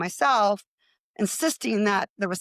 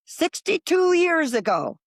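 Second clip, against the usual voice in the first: drawn out; 9 semitones higher, 4 dB louder, slower than usual. Retold or imitated. Quoting the older woman, amazed.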